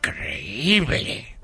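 slow, soft, breathed awe; awed whisper. Acted. Tourist whispering amazement at the builder's works. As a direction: whisper it in wonder.

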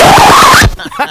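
loud unvoiced laugh; breathy laugh burst. Real, not acted. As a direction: burst out laughing at own pun. Laughing at own joke.

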